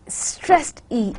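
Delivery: ordinary talk, nothing marked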